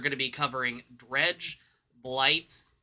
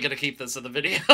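ordinary delivery

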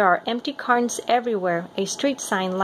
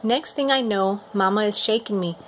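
ordinary delivery

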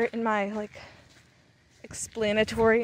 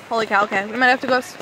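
normal talking voice

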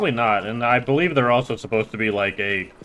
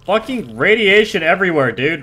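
frustrated outburst; 6 semitones higher, 5 dB louder than usual. Real, not acted. Annoyed by radiation.

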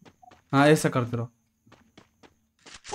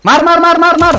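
high, loud rapid-fire urging; urgent shouting; 8 semitones higher, 6 dB louder than usual. Real, not acted. Player urging teammate to shoot mid-fight, urgent shout. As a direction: yell the order over and over.

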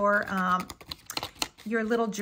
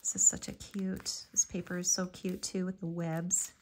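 hushed, breathy murmur to herself; whispered aside; 4 semitones lower, 7 dB quieter than usual. Real, not acted. Crafter admiring the spiderweb paper, whispered aside. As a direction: drop to a soft private whisper.

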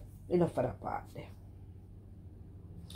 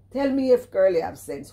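indignant demand; 4 semitones higher, 8 dB louder than usual. Real, not acted. Repeated indignant challenge.

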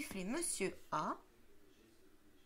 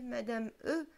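usual speaking voice

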